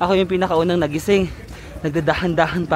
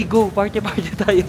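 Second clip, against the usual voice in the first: normal talking voice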